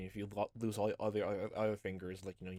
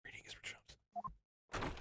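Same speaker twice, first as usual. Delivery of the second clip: squeaky whisper; 10 dB quieter, much slower than usual. Acted. Silly character line.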